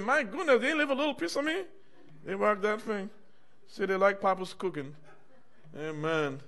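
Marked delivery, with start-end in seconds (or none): 0.0-1.6 high, quick, laughing through the line; laughing mock complaint. Son finds the chicken gone, laughing high complaint. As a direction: chuckle out the son's dismay.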